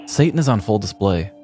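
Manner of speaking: usual speaking voice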